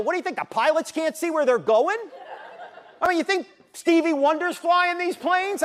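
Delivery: loud, high exasperated tirade; indignant rant. Retold or imitated. Speaker yells at the TV commentator in loud, high exasperation. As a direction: rip into the commentator, fed up.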